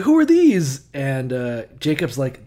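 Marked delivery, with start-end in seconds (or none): 0.0-0.8 much higher, louder startled question; surprised Esau. Retold or imitated. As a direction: jump up in pitch in mock surprise. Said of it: "he's like".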